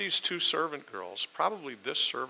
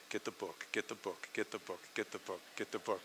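low, soft chant-like repetition; muttered self-reminder; 3 semitones lower, 14 dB quieter than usual. Acted. Forgetful person heading to another room, muttering a reminder. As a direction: drum it into your memory under your breath. Repeating an errand to remember it.

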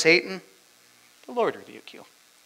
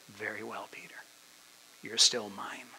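soft, lowered, reassuring voice; tender acceptance; 4 semitones lower, 11 dB quieter than usual. Acted. Jesus reassures Peter after his failure, tender. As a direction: accept him gently and quietly.

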